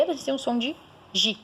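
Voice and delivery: teacher sounds out the soft gi; sound demo. Shown as a demonstration. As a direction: soften the G in gi. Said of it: "como é G e E".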